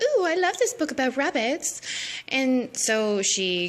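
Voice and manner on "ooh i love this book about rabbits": put-on high breathy girlish gush; girly Alice voice. Alice cooing over the book, high girlish voice. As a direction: coo it like a giddy schoolgirl. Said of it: "she's like".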